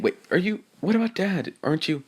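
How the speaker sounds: a touch of annoyed disbelief; a little pissed. Acted. Yang pressing her mother about Dad, mildly pissed. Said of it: "Yang gets a little pissed saying".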